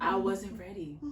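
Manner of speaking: half-laughing fake cry; a hammed-up sobbing confession; mock wail. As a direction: wail it in jest.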